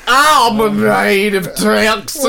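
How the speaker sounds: slow, exaggerated Texan drawl; hammed-up Texas accent; mock Texan accent. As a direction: stretch it out like a cartoon Texan. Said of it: "went to Texas and you were like".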